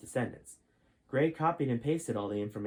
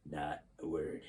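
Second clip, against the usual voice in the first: quiet warning; 7 semitones lower, 6 dB quieter, slower than usual. Acted. General warning Gray.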